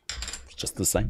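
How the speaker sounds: near-whispered, dropped right down; under-breath aside. Real, not acted. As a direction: mutter it to yourself, resigned. Muttered aside about same answer.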